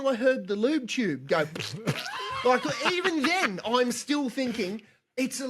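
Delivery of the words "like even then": words through a laugh; giggling disbelief. Real, not acted. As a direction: laugh while you say it. Laughing while retelling.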